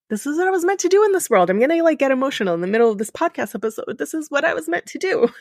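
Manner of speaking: rising pitch, voice catching with emotion; getting teary mid-sentence, laughing through it; choked up, emotional. Real, not acted. Podcast host moved by her own mission, emotional. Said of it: "It almost makes me emotional".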